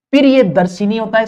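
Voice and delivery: ordinary talk, nothing marked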